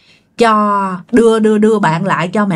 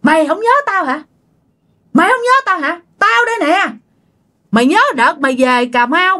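high-pitched accusing voice; vengeful spirit, acted; 6 semitones higher than usual. Spirit in the aunt confronting dad, acted indignation. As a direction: press the grievance, demanding he remember. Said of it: "Cái mợ ba mới nói rằng á là".

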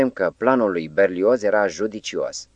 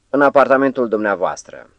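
higher and louder, cheeky and overfamiliar; blurted, familiar; 6 semitones higher, 5 dB louder than usual. A narrator's reading. Madman to Berlioz, a higher, louder, cheeky blurt. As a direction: toss it out with a wink. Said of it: "trânti deodată pe un ton familiar".

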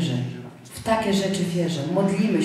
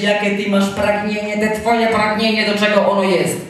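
mocking devil; 4 dB louder than usual. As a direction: sneer the doubting questions like the devil. Voices the devil questioning your desire.

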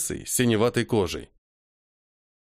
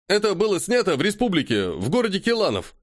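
excited report; 8 semitones higher than usual. Acted.

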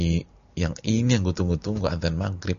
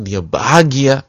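louder, slow, swelling into joy; joyful burst; 4 semitones higher, 11 dB louder, slower than usual. Acted. Preacher describing the new convert's happiness, loud and slow.

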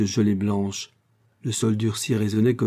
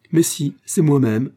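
higher and louder, happy exclamation; joyful cry; 5 semitones higher, 6 dB louder than usual. A narrator's reading. Olivier rushing in to embrace his friends, joyful cry. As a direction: burst out with delight at the reunion. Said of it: "s'écria-t-il en les étreignant".

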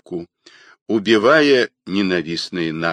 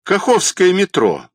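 much higher, louder interjection; sharp alto; 10 semitones higher, 5 dB louder than usual. A narrator's reading. Alto pushes through to the phone, sharp and high. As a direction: break in with a raised sharp voice. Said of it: "прорвался к телефону резкий альт".

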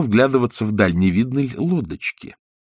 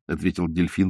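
usual speaking voice

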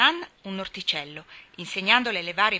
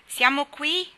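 loud, higher hail; repeated call; 8 semitones higher, 9 dB louder, slower than usual. A narrator's reading. Boy calling again from below, loud hail. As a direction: shout it up again. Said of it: "ripeté la voce".

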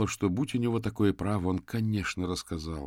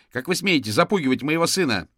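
high, loud indignant shriek; furious mother at full volume; outraged yelling mother; 8 semitones higher, 8 dB louder than usual. A narrator's reading. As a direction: yell in defence of your child. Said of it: "завопила она".

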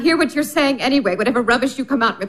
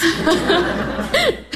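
light laugh; 5 semitones higher than usual. Acted. Laugh recalling the Baron.

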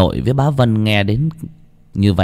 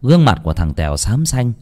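ordinary delivery, nothing marked

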